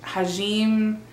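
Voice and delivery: normal talking voice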